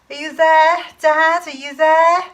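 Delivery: high, loud questioning shout; shouted call. Acted. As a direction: shout into the woods for an answer. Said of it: "I shouted".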